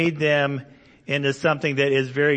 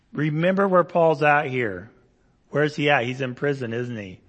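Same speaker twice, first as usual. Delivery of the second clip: usual speaking voice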